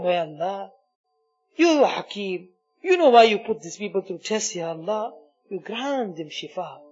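normal talking voice

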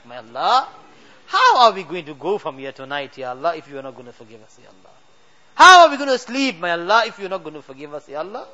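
usual speaking voice

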